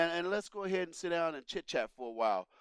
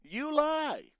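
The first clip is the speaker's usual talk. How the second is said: quoted accusation; 9 semitones higher than usual. Retold or imitated. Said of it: "Joe Wilson said".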